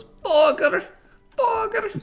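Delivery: put-on high squeaky kid voice; kid saying burger. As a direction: squeal it like a little kid meme. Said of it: "and he goes".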